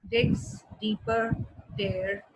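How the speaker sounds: deliberate word-by-word example; picking out D words. Shown as a demonstration. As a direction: space out each D word for the class. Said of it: "the d, d, d sound".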